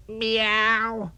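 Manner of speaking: high, drawn-out meow; a cow trying to be a cat, mew it high. Acted. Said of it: "she said".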